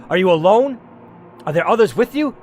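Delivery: a hint of a suspicious gunman demanding answers; armed man. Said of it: "The armed man asked".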